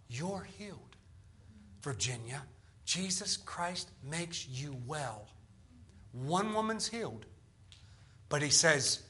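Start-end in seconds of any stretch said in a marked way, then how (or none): 0.1-5.3 low, very soft, slow re-enactment; quiet imitation of a healer; hushed healing pronouncement. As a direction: pronounce it gently and solemnly, almost under the breath. Retold healing spoken to one woman.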